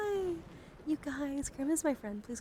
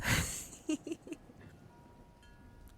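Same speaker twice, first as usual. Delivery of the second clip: a short, much higher whispered giggle; breathy giggle; 8 semitones higher than usual. Real, not acted. Laughing at her own vibes.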